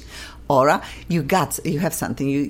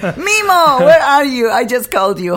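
high, loud call across the room; host calling loudly for the producer on air; 9 semitones higher, 6 dB louder than usual. Real, not acted. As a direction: call out for Mimo with a laugh.

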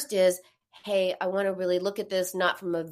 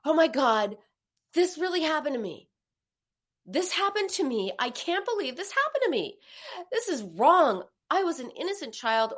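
mock outrage, pitch rising, higher; anger; 8 semitones higher than usual. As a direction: voice an indignant victim, half-acted. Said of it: "Then anger".

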